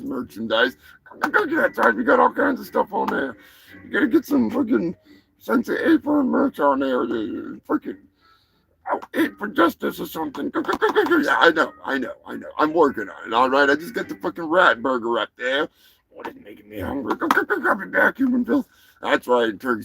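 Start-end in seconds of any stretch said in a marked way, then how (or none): 1.2-11.2 high, excited turkey voice; gobbling turkey character. Acted. Plays gobbling turkey mascot. 16.2-18.7 quick, high turkey chatter with gobbles; gobbling turkey character. Acted. Plays gobbling turkey mascot.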